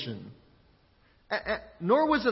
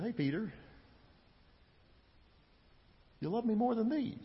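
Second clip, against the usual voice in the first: much softer, drawing out the question with a pause after the name; hushed pointed question; 11 dB quieter than usual. Retold or imitated. Jesus asking Peter about the boats and nets, hushed. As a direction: put it to him quietly, as an intimate challenge. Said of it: "Jesus may have been pointing toward".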